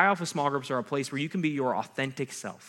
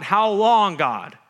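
yell at God; 5 semitones higher, 7 dB louder, much slower than usual. Shown as a demonstration. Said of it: "teaches us to yell at God".

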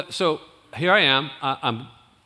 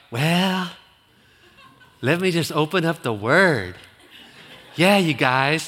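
Kevin's way of talking; 3 semitones higher, slower than usual. Retold or imitated. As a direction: lightly mimic a shy teenage guest speaker. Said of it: "That's how he talked".

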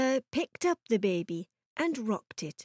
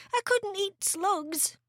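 high, drawn-out protest; boy's dismayed cry; 9 semitones higher, 4 dB quieter, slower than usual. A narrator's reading. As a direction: squeamish little boy refusing slowly. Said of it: "George cried out".

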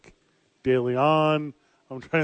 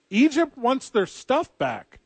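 mock Egypt demand; 10 semitones higher, slower than usual.

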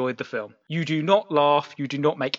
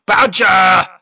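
sudden full-throated yell, louder and higher; bellowed shout; 5 semitones higher, 11 dB louder than usual. Retold or imitated. Ruining the film by bellowing a word at the audience, loud yell. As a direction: bellow it out across the silent room. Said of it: "And I just bellow out".

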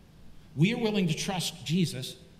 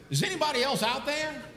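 high, panicky mock voice; raised an octave; 7 semitones higher than usual. Man on the branch seeks another rescuer, high-pitched voice. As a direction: call out with the pitch jumped way up. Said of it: "voice had raised another octave".

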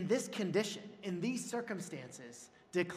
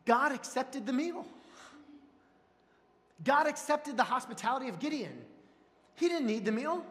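high, raised preaching voice; emphatic preaching; 7 semitones higher, 4 dB louder than usual. Real, not acted. Preacher marvels to congregation that God waited, emphatic and raised. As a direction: drive each phrase home with force.

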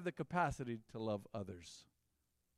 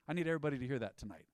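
normal talking voice

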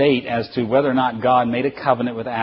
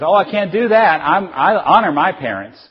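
high, loud, earnest refusal; hammed-up virtuous protest; protesting son; 6 semitones higher, 7 dB louder than usual. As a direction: play the over-dutiful grown child. Acted son refusing an errand.

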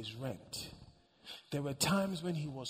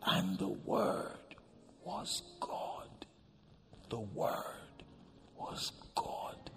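slow scripture whisper; 3 semitones lower, much slower than usual. Real, not acted. Preacher whispers the Word was God to congregation. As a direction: breathe each word out slowly, unvoiced.

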